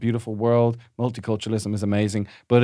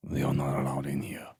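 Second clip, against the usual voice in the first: low menacing whisper; 10 semitones lower, 10 dB quieter than usual. Acted. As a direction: hiss it like a guard. Whispered guard.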